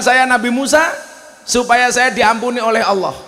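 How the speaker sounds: high, pleading woman's voice. Acted. Woman begging Musa to pray for her, high and pleading. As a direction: beg forgiveness as the woman. Woman confesses killing child.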